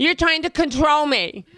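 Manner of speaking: high, slow, drawn-out accusation; exasperated mock accusation. Girlfriend accusing boyfriend, hammed-up exasperation. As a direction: stretch each word in hammed-up outrage.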